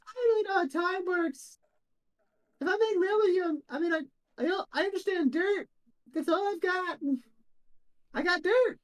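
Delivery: very high, candid little ant; high-pitched ant voice; tiniest little voice. Acted. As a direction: squeak it as a tiny ant out of its depth. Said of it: "the tiniest little voice go".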